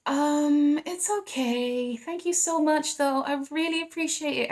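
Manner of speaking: high, slow, hesitant thank-you while backing off; politely squirm out of it; imitating a high, uneasy no thank you; awkward polite decline. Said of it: "you're just like".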